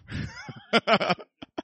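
breathy unvoiced chuckle; a quick laugh under his breath; airy laugh. Real, not acted. Pastor chuckling at the student's letter, a breathy laugh.

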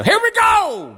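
loud, high, drawn-out kickoff; hyped up. Real, not acted. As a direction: launch it like a show host. Launching the show with hype.

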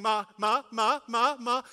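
urgent, rapid-fire mock hollering; imitated toddler screaming. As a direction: imitate a child yelling for mum over and over. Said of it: "he's screaming".

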